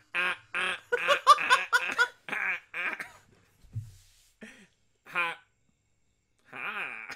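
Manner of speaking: stilted, spaced-out ha-ha; staged laughter. Acted. Grief-filled lover who can't stop laughing, stagey laugh. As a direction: punch out loud, separate fake laughs. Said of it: "I can't stop laughing".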